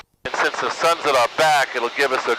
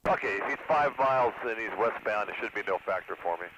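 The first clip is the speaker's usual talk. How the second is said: pilot radio call, quieter; over the radio; 3 semitones lower, 10 dB quieter than usual. Real, not acted. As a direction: speak into the aircraft radio.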